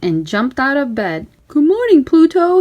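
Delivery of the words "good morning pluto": a hint of a high cheerful mouse voice; bright morning hello to Pluto; lightly voiced Mickey. A narrator's reading. Mickey greeting his dog Pluto, lightly high and cheerful.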